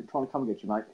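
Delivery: normal talking voice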